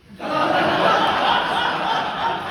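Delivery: bursts out laughing at the absurdity; a big unguarded laugh breaking through; helpless laugh. Real, not acted. Reading old charade notes, breaks into laughter.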